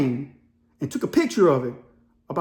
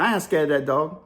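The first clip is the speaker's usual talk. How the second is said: lightly imitated Vietnamese accent, lower; 5 semitones lower than usual. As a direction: retell it with a hint of a Vietnamese accent. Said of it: "In that accent, just like that".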